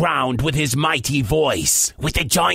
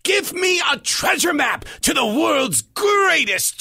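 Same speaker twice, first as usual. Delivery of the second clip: higher, grandly commanding pirate voice; 9 semitones higher than usual. Acted. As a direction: order the magic stone about. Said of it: "stared into the glittering stone and commanded".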